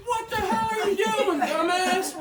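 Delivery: high, squeaky mocking voice of his friend; mock angry buddy. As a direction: imitate the friend scolding you. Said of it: "he's like".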